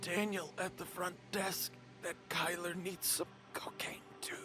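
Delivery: high, whispery plea; faint, strained begging; feeble injured kid. Acted. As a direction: whisper it with your last strength.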